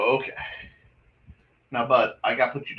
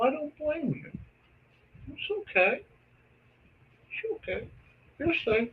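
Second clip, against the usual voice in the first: high, soft comforting coo to a pet; tender high-pitched pet talk; reassuring; 5 dB quieter than usual. Real, not acted. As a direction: soothe the frightened cat in a tiny high voice. Reassuring the cat after coyotes.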